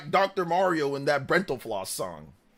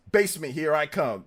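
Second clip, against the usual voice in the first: ordinary talk, nothing marked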